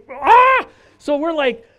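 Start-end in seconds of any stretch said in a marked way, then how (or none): 0.1-0.7 mock scream. Acting out the mob threat.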